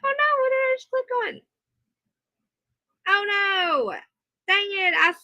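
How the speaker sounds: high-pitched oh-no of alarm; dismayed surprise. Real, not acted. Accidentally clicked the wrong thing.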